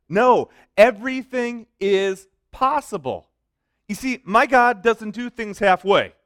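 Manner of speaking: triumphant preacher's declaration; loud preaching. Real, not acted. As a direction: proclaim it with full force.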